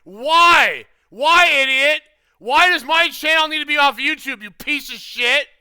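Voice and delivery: repeated yell. Real, not acted. Heckler yelling at the streamer, loud and furious. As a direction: hammer the why like an outburst.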